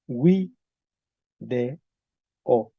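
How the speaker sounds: "wi de o" as separate syllables, starting with w; Latin pronunciation. Shown as a demonstration. Teacher shows how it was said in Latin, Latin pronunciation. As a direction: say "wi de o" apart, with no v sound. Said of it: "cómo se pronuncia en latín".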